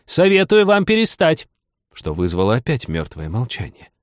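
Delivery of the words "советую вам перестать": high loud warning; loud shout. Acted. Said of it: "громко крикнула".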